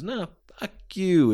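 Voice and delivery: high-pitched mock retort; acted heated comeback. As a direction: throw the insult right back. Heel retorting to mom.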